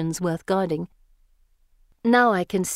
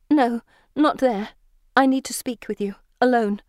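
higher, louder urgent plea; urgent, shaken; 5 semitones higher, 4 dB louder than usual. A narrator's reading. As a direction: insist urgently, pausing between phrases. Shaken heroine urgently refuses.

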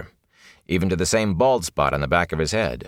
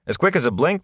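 ordinary delivery

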